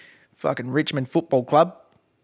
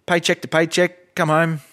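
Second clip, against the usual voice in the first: ordinary talk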